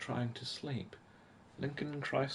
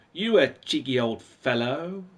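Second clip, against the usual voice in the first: another go at a light British accent, a bit slower; mock UK accent; 6 dB louder than usual. Shown as a demonstration. Reading old magazines, retrying a British accent. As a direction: try the cheeky British line again, slower. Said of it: "I'll give it a go".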